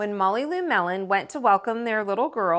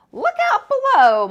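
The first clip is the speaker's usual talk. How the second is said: high, loud yell, much higher and louder; scream; 10 dB louder than usual. Acted. Girl screaming as she races down the hill, loud and high. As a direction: shout it as she hurtles downhill. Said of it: "Molly Lou Melon screamed".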